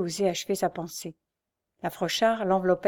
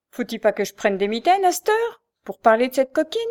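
loud, higher contemptuous snap; angry retort; 5 semitones higher, 7 dB louder than usual. A narrator's reading. Mother rages at her son about the girl upstairs, loud and scornful. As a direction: spit out the scorn.

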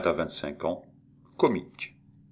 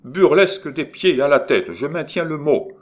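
loud, high, insisting on the word; heated insistence; 6 semitones higher, 7 dB louder than usual. Acted. Maurice insisting loudly to Étienne in their quarrel. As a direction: dig in and defend it hard. Writers arguing over the play.

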